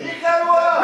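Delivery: high, projected mock hurrah; cheering call-out. Real, not acted. Host hailing the scoring rule to the room, cheering. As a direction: cry it out like a slogan.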